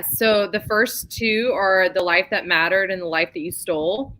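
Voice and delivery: ordinary talk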